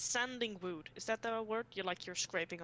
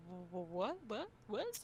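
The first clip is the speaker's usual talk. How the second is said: mock confused what; 6 dB quieter, slower than usual. Retold confused reaction.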